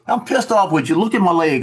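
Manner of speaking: a touch of mock outrage; angry complaint. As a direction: show him you're pissed. Angry cousin shows welts.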